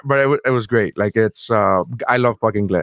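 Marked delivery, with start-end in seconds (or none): none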